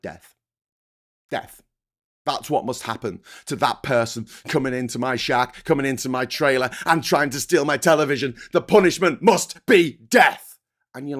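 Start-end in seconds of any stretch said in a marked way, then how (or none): 0.0-1.6 terse, low mock-American verdict; put-on American, softly spoken and final; American accent. American answering how to punish a thief, mock American accent. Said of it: "do an American accent". 2.3-10.6 hammed-up American, building to a loud finish; American accent. American homeowner demanding punishment for a burglar, hammed-up American accent. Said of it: "do an American accent".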